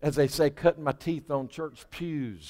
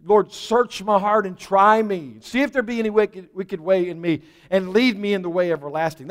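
fervent prayer; 3 semitones higher, 5 dB louder than usual. Acted. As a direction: plead it to the Lord. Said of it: "we need to be praying".

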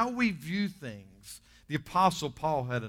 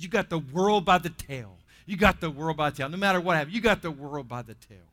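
loud, lightly voiced Cajun pep talk; Cajun lady; 2 semitones higher, 8 dB louder than usual. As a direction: imitate a Cajun lady insisting, loudly. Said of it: "I can't do Cajun good".

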